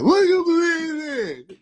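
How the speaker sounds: high, loud, slow imitated question; mock challenge. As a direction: draw it out in a put-on high voice.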